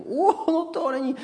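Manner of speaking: squealed high in mock astonishment; hammed-up gasp of disbelief. Family amazed at new house. (hraný úžas, vysoko vypísknuto)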